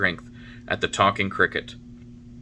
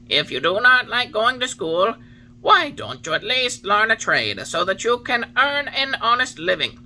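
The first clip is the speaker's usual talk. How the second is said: higher, louder solemn cricket warning; Talking Cricket warning; 13 semitones higher, 5 dB louder than usual. A narrator's reading. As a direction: preach it like a wise old insect. Cricket advises in same tone.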